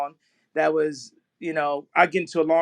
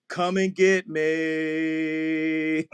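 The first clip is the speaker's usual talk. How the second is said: defiant taunt; 4 semitones lower, much slower than usual. Real, not acted. Taunting the establishment.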